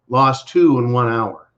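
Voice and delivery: usual speaking voice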